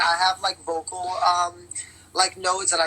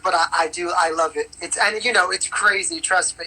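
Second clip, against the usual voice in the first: usual speaking voice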